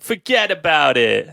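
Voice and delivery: slow, high-pitched New York Italian; New York accent. Shown as a demonstration. Showing off the cop accent for friends, exaggerated New York accent. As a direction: draw it out like a caricature New Yorker. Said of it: "should I try it again?".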